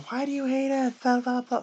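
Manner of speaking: high, whiny mock voice of a heckler; mocking taunt. Mocking a fan who taunted him about his rankings, high and whiny. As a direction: sneer it in a silly high nasal-free squeak of a taunter. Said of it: "someone taunting me going".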